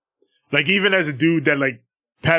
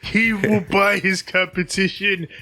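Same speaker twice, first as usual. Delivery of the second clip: villain promo; 6 semitones higher, slower than usual. Pretend. Continuing the villain promo bit.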